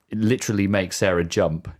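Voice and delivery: ordinary delivery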